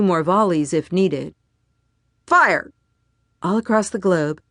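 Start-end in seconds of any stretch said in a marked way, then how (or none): 2.3-2.7 loud, high barked command; shouted order. A narrator's reading. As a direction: yell the order to fire. Colonel gives firing order.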